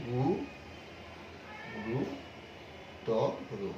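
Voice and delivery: গু, ত, রু with long gaps between; isolated, spaced syllables. Shown as a demonstration. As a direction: spell out the word one syllable at a time, pausing after গু and ত. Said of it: "উচ্চারণটি দাঁড়াবে এরকম".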